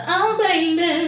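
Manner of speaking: slow drawn-out singer imitation. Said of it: "I only thought she had one".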